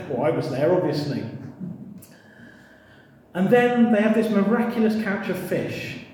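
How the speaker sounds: usual speaking voice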